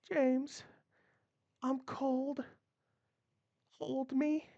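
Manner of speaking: high, soft dying plea; whisper-weak, reaching for him; fading plea. Acted. Dying wife asking her husband to hold her, soft and high.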